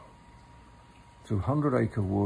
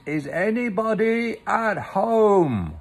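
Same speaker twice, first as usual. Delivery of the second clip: high, loud, slow call; calling very loudly; 10 semitones higher, 7 dB louder, slower than usual. Acted. Pooh shouting into Rabbit's hole, very loudly. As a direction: bellow it down the hole so nobody can miss it. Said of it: "called Pooh very loudly".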